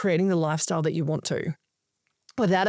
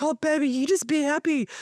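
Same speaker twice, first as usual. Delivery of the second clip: higher-pitched cooing mum voice; doting mum; 8 semitones higher than usual. Retold or imitated. Mum reassuring her child about the house, imitated doting voice. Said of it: "And mum's like".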